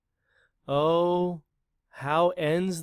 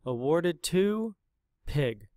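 plain reading voice

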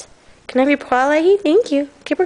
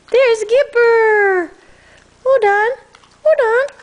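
high, loud and excited, calling the dog; rising excitement while holding the dog back; excited dog-talk; 9 semitones higher, 3 dB louder, slower than usual. Real, not acted.

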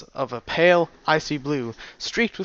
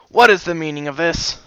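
gruff demand; 4 semitones higher, 6 dB louder than usual. Acted. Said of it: "he barked in a gruff voice".

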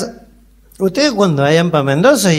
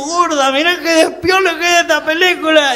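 telling the wife; 11 semitones higher than usual. Retold or imitated. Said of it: "Y le digo".

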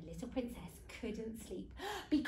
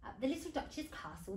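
ordinary delivery, nothing marked

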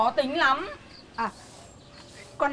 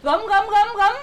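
loud repeated call of a name; 7 semitones higher, 7 dB louder than usual. Real, not acted. Mother calling the neighbour girl into the house, loud call. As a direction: call her in from across the yard.

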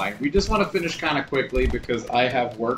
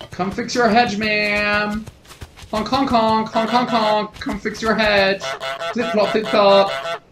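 higher, louder silly calling; silly clown; 6 semitones higher, 5 dB louder, slower than usual. Pretend. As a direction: honk and holler like a clown. Calling out to gardener as goose.

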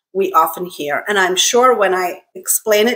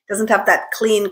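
ordinary delivery

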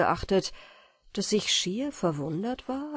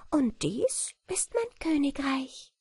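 proud fairy queen; 7 semitones higher than usual. A narrator's reading. Wieglinde speaks in story.

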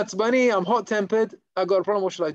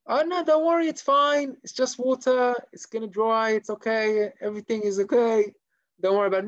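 insistent reassurance; 4 semitones higher, 3 dB louder than usual. Acted. Soaked man excusing the shamash, over-eagerly reassuring. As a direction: brush it off brightly, acting unbothered.

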